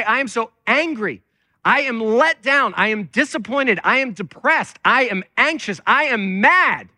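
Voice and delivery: unload on God, hammering each feeling harder; acted angry venting. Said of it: "bring all of those feelings to God".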